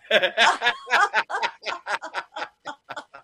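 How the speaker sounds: long, high cackling laugh; helpless high-pitched laughter; real laugh. Real, not acted. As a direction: crack up at your own punchline. Laughs at own punchline.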